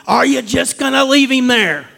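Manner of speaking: high, loud rebuke; God yelling. Retold or imitated. Pastor retelling God yelling at him, loud rebuke. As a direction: call him out sharply as God would. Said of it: "it took God yelling at me".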